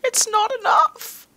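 very high, slow put-on squeal; mock desperate wail. Player in a weird mood, mock desperate wail. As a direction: wail it slowly way up high, hammed-up.

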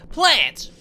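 high, loud burst of delight; excited exclamation. Real, not acted. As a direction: shout it out in sudden excitement. Spotting a plant in the game.

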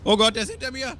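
panicked cry, high and loud; being chased. Real, not acted. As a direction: shout in panic as you flee. Enemy chasing him in game.

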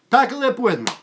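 high, loud hyped callout; excited callout. Real, not acted. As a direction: call it out to the viewers, pumped. Catchphrase called to viewers.

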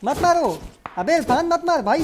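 high, loud, insistent begging; real panicked gamer shouting; frantic shouted plea. Real, not acted. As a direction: yell urgently at teammates to stop. Frantically stopping teammates from killing.

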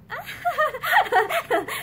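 high, drawn-out artificial laughter; forced laugh. A narrator's reading. Pansy covers her unease before him, forced high laugh. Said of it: "She forced a laugh".

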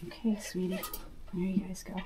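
hushed reassurance to the doe; soft tender coaxing. Real, not acted. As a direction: soothe the mama rabbit gently. Soothing the rabbit and kits.

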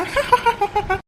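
high breathy burst of laughter; short high cackle; laughing. Acted. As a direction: laugh at her silly excuse. Laughing at friend's excuse.

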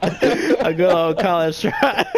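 slow repeat through real laughter; laughing. Real, not acted. Gamer tickled by teammate's college try, laughing. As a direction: crack up while echoing the phrase.

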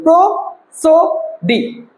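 প্রসোডি in three separate beats: pro, so, di; very slow প্রসোডি with gaps between pro, so and di; syllable by syllable. Shown as a demonstration. Teacher counting three syllables for students, one by one. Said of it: "তিনবারে কিন্তু আমরা এই ওয়ার্ডটাকে".

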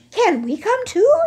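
put-on high, loud childlike plea; eager young camel. Youngest camel begging old Hashmakaka, high and eager. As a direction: beg not to be left behind. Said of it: "asked the youngest camel".

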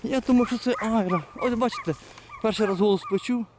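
high, eager rush of surprise; thrilled to hear the hound's voice; a hunter suddenly hearing his dog give voice, excited. Real, not acted. Hunter thrilled, hears hound baying.

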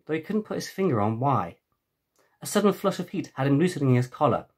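usual speaking voice

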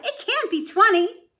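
high loud excited guess; crying out. A narrator's reading. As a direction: she can hardly believe it. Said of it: "she cried".

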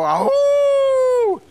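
high, loud mock howl; wolf howl. Shown as a demonstration. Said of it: "you go".

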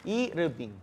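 "Irving" with an added vowel, i-ru-bing; Korean pronunciation. Shown as a demonstration. Tutor repeating friend's name the Korean way, three-syllable pronunciation. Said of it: "en Corea te van a decir".

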